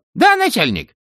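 very high squeaky underling; distant voice cutting back in. A narrator's reading. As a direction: pop back on the line, high-pitched. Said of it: "а потом прорезался снова". (далёкий писклявый голос, снова на связи)

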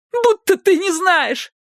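high, slow weeping accusation; bitter through tears; tearful girl. A narrator's reading. Sobbing girl accuses him.

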